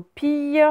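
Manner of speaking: "pille" said high as peey; "pille" stretched, no L sounded. Shown as a demonstration. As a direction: end "pille" on a y glide. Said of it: "on a pris le son ye".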